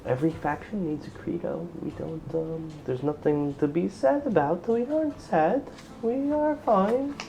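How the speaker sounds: high, hushed and insisting; quiet lady hiding her sorrow; soft, guarded young woman. Acted. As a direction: deny the sadness softly, rising at the end. GM voices the sad woman.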